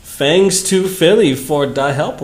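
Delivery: normal talking voice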